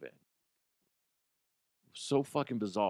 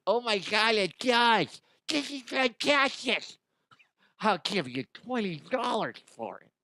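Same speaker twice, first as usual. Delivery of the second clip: much higher gushing buyer voice; mock excited buyer; 10 semitones higher than usual. Mocking a fan buying a shaped Cheeto, a high gushing voice. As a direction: gush like a fan who found treasure.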